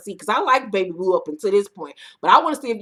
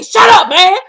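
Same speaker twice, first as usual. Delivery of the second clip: much higher, much louder barked retort; mock shout; 9 semitones higher, 13 dB louder than usual. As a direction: snap it like an angry outburst. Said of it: "And then Baby Blue say".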